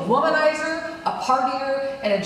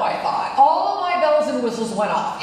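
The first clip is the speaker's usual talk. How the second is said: ordinary talk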